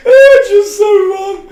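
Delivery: slow, high, laughing to tears; crying with laughter. Real, not acted. Reacting to the wolf-howl prank, crying with laughter. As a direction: squeeze it out while crying laughing.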